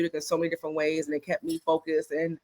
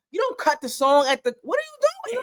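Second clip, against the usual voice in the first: high, incredulous what are you doing; mock outrage; 13 semitones higher than usual. Host scolding a DJ who cut the song, incredulous. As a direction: scold the DJ in disbelief.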